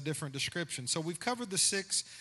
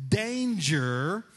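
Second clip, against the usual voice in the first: booming preacher cry, loud and drawn; preacher impression; 8 dB louder than usual. Shown as a demonstration. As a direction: call it out like a fired-up preacher. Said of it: "do your best preacher impression like this".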